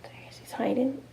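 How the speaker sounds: hushed storytelling whisper. Real, not acted. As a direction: whisper it so the bear won't hear. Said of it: "teacher whispers aside to class".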